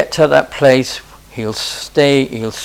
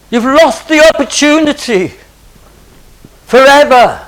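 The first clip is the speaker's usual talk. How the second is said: rising preaching; 5 semitones higher, 4 dB louder than usual. Acted. Preacher urging not to miss Jesus.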